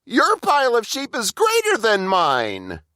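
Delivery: high, whining complaint; high, whiny Burple; whiny little brother. A narrator's reading. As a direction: sulk it out like a cheated kid. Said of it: "Burple whined".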